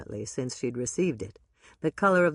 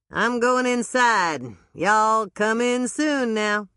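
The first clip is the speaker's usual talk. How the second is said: high, loud, slow hammed-up Southern drawl; slow, high bad Southern accent farewell; 6 semitones higher, 4 dB louder, slower than usual. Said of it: "a voice with a really bad Southern accent".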